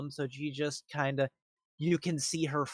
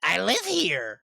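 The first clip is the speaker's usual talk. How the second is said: lightly voiced eccentric witch, pitched up in places; halting, higher old-witch voice; old crone witch; 7 semitones higher, 5 dB louder than usual. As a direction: play a crone groping for the measurement.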